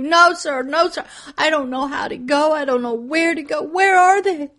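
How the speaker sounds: high, loud, pleading refusal; anxious protest. Retold or imitated. Preacher telling God she doesn't know where to go, frantic. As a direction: plead that you can't do it. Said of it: "protesting to God in vision".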